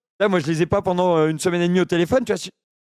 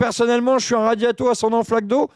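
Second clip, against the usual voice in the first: cheeky delivery man voice. Acted. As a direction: play an absurd chirpy delivery man. Second prank call, delivery man.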